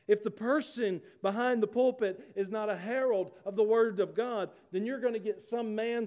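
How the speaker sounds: high, emphatic pulpit delivery; forceful preaching. Real, not acted.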